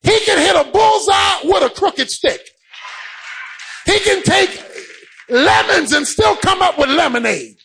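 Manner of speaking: high, driving sermon delivery; impassioned preacher in full cry; full-force preaching. Real, not acted. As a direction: preach it hard, punching out each phrase.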